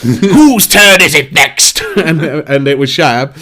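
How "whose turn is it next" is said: loud, high, very drawn-out gatekeeper call; shouting gatekeeper. Retold or imitated. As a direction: bellow the challenge slowly as the gatekeeper. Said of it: "gate key come up and he was like".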